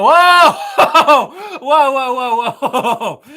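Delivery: loud, high whoa with laughter; real surprise breaking into laughs; excited whoa. Real, not acted. Host reacting to an exploding chat, loud and laughing.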